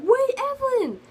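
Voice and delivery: high-pitched call after a friend who runs off. Retold or imitated. Friend calling after Evelyn as she runs away, a high, urgent call. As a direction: call her name to stop her, up high. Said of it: "My friend's like".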